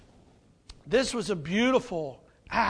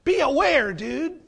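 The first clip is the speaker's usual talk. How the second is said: exasperated disciples; 4 semitones higher, 4 dB louder than usual. Retold or imitated. Disciples scolding Judas.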